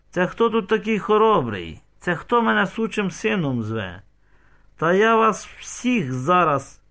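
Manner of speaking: loud, high indignant demand; angry challenge. A narrator's reading. Insulted father threatens the crowd, angry challenge. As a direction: square up to them, stretching the threat.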